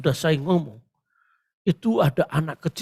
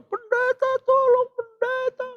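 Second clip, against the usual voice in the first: imitated panicked pleading; 3 dB louder than usual. Mother begs pastor for help, imitated high wail. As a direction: mimic a desperate mother calling out. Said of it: "mamanya bingung teriak".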